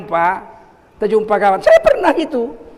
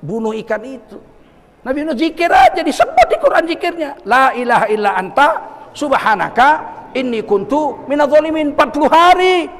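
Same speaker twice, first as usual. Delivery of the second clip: louder, driving sermon voice; fervent preaching; 5 semitones higher, 4 dB louder than usual. Acted. Preacher urges the congregation to trust Allah, fervently. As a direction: preach it with conviction.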